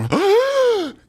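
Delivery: gasp. Real, not acted.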